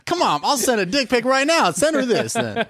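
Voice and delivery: high-pitched petulant protest; hammed-up whiny comeback; mock wimpy texter. Mocking a wimpy texter's threat.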